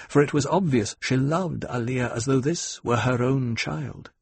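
ordinary delivery, nothing marked

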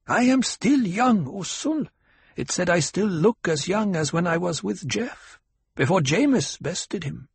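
high-pitched woman's character voice; high, insistent tribeswoman; proud tribeswoman pleading her youth. A narrator's reading. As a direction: lift the voice high and plead your case. Hera protests her youth.

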